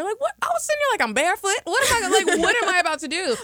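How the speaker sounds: high, loud panicked rambling; mock panic. Panicking hiding in bathroom.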